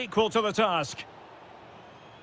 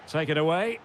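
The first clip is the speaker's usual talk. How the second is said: normal talking voice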